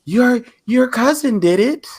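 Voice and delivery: high-pitched imitation of a nagging grandma; put-on high grandma voice; nagging grandmother. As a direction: mimic grandma pushing, voice lifted high. Voicing grandmother's nagging in a story.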